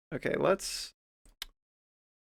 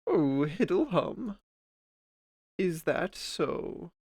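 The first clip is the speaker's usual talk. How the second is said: slow, hushed prophetic intoning; Oracle voice; 2 semitones higher, 5 dB quieter, slower than usual. Retold or imitated. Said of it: "Other Oracle voice lines".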